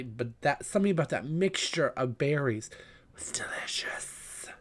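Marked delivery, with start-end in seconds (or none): 3.1-4.5 whisper. Real, not acted. Savouring the pie.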